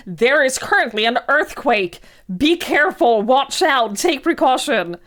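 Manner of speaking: loud, urgent warning call; loud alarm voice. Retold or imitated. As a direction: blare it like a phone alert. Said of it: "a very loud alarm".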